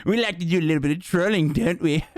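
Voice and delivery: high, fast, giggly troll voice; hammed-up gleeful trickster; mocking chat's prankster voice. Mocking chat's April Fools troller, high giggly voice. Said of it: "that's what you sound like when you do April Fool shit".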